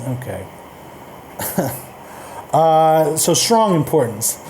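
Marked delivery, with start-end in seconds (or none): none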